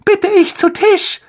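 extremely high put-on woman's voice; gushing hostess aunt. A narrator's reading. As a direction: announce dinner in a piping hostess voice. Aunt rehearses her hostess part.

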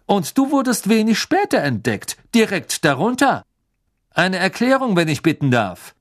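hohe, laute Beamtenstimme; strenger Beamter. A narrator's reading. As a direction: streng und von oben herab. Mr Diggory interrogates. (strenger Ministeriumsbeamter)

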